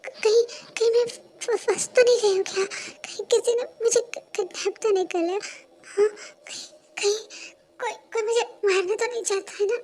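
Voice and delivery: high, stammering, tearful panic; frightened crying. Acted. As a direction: whimper like she's sure she's been kidnapped.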